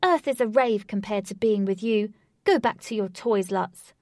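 loud triumphant shout. Acted. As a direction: shout the last word at him. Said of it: "she shouted".

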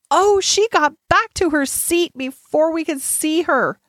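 put-on high, eager child voice; bright-eyed, animated exclamation; excited explaining. A narrator's reading. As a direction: burst out with it in a high voice. Child excitedly explains doll's quickness.

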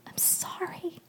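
soft whispered apology, barely voiced; tearful murmur. A narrator's reading. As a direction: murmur it into an ear, very softly. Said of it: "she murmured into my ear".

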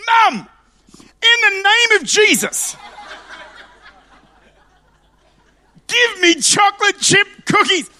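a tiny preacher rebuking, full force; toddler commanding. Retold or imitated. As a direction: toddler shouting from the trolley. Toddler stands up commanding in Jesus' name.

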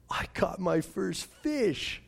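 put-on a small boy marveling at his first catch; hushed wonder. Proud kid's hushed wonder.

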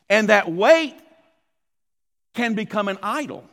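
preaching. Acted. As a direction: preach it with swells and hushed endings. Sermon delivered at full force.

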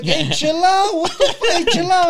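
high-pitched yelp of indignation; retold panicky protest. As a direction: act out protesting as a guard drags you off. Retelling protest at being grabbed.

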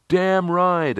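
high cheerful call from the group. A narrator's reading. Team members answering newcomer in chorus, calling out high. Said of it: "they called out".

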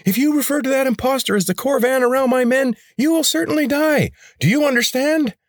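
shrill threat from a furious would-be king. A narrator's reading. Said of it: "The rebel leader's voice rose even higher".

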